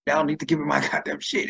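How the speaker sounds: normal talking voice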